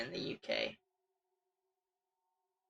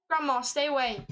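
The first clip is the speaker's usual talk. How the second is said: ordinary talk, nothing marked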